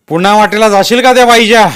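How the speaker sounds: loud, high booming warning; booming threat. A narrator's reading. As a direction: make the temple ring. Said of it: "देऊळ पुन्हा घुमलं". (घुमणारा धमकीचा आवाज)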